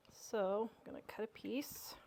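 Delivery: quiet whispered aside; whispering. Real, not acted. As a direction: whisper it to yourself. Aside while cutting for son.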